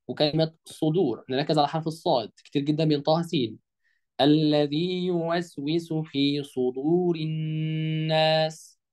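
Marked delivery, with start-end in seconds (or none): 4.2-8.8 very slow tajweed chant; teacher's model recitation, drawn out; Quran recitation. Shown as a demonstration. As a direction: sustain the long vowels and hummed nn on one note. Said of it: "الآية اللي بعديها نركز".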